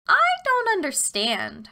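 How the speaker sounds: high, slow, feigned confusion; innocent girl voice. A narrator's reading. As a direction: play dumb like a little girl. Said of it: "Gretel said".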